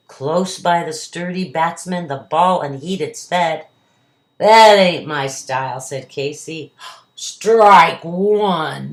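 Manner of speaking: lively storybook poem reading; reading to a toddler. A narrator's reading. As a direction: read the ballad to a child. Reads the poem aloud.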